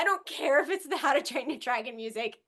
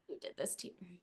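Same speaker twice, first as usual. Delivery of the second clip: much softer, hushed take on the quote; soft quoted line; 3 semitones lower, 15 dB quieter, faster than usual. Retold or imitated. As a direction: drop it down to a near hush.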